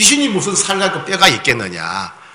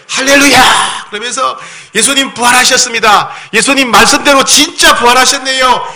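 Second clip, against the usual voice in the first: jubilant shouting; 9 semitones higher than usual. Pretend. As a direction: shout for joy like disciples who believed. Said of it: "막 박수치고 막 소리를 지르고".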